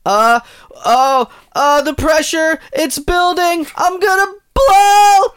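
climbing strained squeal till bursting; building panic. Pretend. Mock pressure building up.

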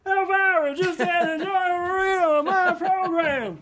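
very high, drawn-out excited squeal; giddy fan squeal. Acted. Host after the hostess says his sign-off, giddy high squeal.

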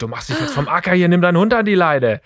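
rising, heated telling-off; hammed-up anger at the other owner; angry yell. Snapping at the dog owner, mock angry. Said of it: "Ich bin richtig ausgerastet".

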